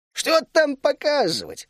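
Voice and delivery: a high, slow scoffing question; amused snort. A narrator's reading. Gnome Berlan scoffing at the traveller's request, slow and high. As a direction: brush it off with a chuckle in the voice. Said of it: "хмыкнул Берлан".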